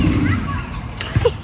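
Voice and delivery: short breathy laugh; high laugh. Real, not acted. Mom laughing about her awkward son, amused. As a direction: let out a quick high laugh at yourself.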